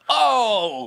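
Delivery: dub actor voice. Retold or imitated.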